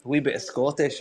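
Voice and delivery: light put-on Scottish brogue; Scottish accent. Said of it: "That was a good Scottish accent".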